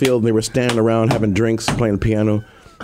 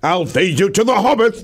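a hint of a shouting ogre; mock threat; 8 semitones higher than usual. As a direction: threaten playfully, voice up high. Continues the yelling character.

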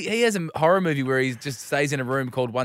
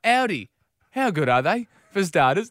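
raised, louder voice of a keen new colleague; Sarah; 4 semitones higher, 6 dB louder, slower than usual. Retold or imitated. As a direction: butt in loudly to join the chat. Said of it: "swings her chair out and goes".

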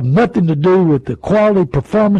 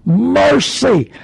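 exclamation; 7 semitones higher than usual. Real, not acted. Exclamation at dealers' losses.

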